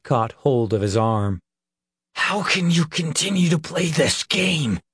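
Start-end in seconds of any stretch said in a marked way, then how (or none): 2.2-4.8 higher, tense angry hiss; hissed through teeth. A narrator's reading. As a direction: spit it through clenched teeth. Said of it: "he hissed through clenched teeth".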